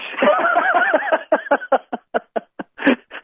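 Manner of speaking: long high laughter; a long burst of laughing. Real, not acted. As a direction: crack up at the joke. Laughs at his own joke.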